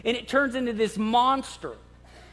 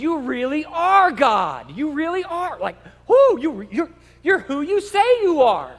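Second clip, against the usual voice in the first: awed worship; 5 semitones higher, 6 dB louder than usual. Retold or imitated. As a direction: gasp out your wonder. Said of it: "they said".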